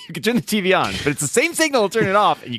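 high, incredulous mock complaint; exasperated. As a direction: plead with the TV in disbelief. Exasperated quote at the TV.

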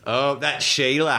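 slow Australian accent imitation. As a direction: drawl Sheila the Australian way. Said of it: "you're doing really good".

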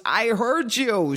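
high, slow, drawn-out comeback; exasperated reply. Acted. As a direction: stretch it out high as if tired of hearing it. Man answering her repeated line in joke.

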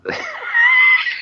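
a squeaky burst of laughter; high squealing laugh. Real, not acted. Laughing at failed impression.

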